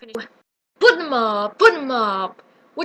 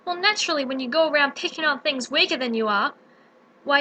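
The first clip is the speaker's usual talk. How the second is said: ordinary talk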